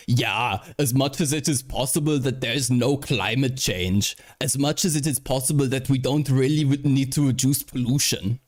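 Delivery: mock Austrian accent quoting Arnold; Schwarzenegger impression. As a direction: drag out the Austrian vowels. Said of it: "Terrible accent, this is perfect".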